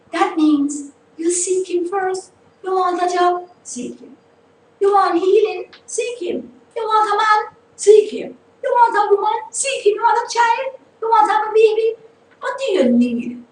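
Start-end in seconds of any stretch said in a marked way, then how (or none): 2.6-13.4 higher, louder preaching run, punching each question; preaching at full force. Acted. Host preaching to radio listeners, rising full-force preaching. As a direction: fire the questions at the congregation like a preacher.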